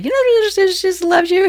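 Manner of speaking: gabbled, high nervous rush; said too fast. Retold or imitated. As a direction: blurt it out high and hurried. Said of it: "I said it too fast".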